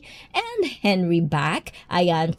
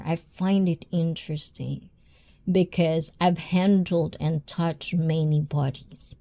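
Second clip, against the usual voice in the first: normal talking voice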